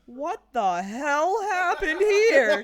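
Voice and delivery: high, loud, slow outburst; loud, drawn-out, half-laughing shock; shocked drow. Acted. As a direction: bellow it in disbelief. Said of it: "she'll be like".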